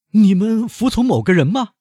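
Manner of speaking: high, loud, disbelieving question; high shocked banker asking, rising at the end; incredulous banker. Acted. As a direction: demand it in disbelief as the captive banker. Danglars questions the bandit chief.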